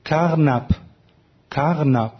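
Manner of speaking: کارنپ as "KAAG-nap", throaty r, said separately for demonstration; each کارنپ set apart, falling, r in the throat; German pronunciation. Shown as a demonstration. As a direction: show the German کارنپ, vowel between "aa" and "a". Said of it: "تلفظ کارنپ من الان به آلمانی".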